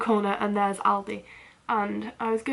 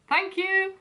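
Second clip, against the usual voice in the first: squeaky, uneasy thank you; awkward high thanks; 8 semitones higher than usual. Retold or imitated. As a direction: thank him just to make it stop.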